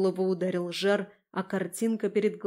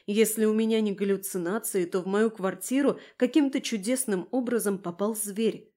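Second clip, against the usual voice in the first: ordinary delivery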